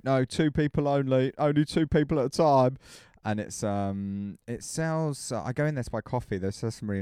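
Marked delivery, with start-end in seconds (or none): 0.0-2.8 louder, firm shopkeeper telling customers off; lightly voiced shopkeeper laying down the rule; strict shopkeeper. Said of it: "he's really".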